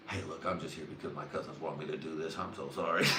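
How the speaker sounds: soft, low mock apology; sheepish apology. Approaching a girl on cousins' dare, sheepish apology. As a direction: own up meekly, keeping it small.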